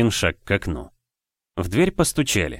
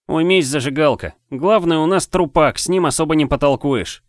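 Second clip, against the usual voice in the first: cocky taunt; 7 semitones higher, 4 dB louder than usual. Acted. Ri bossing the girl.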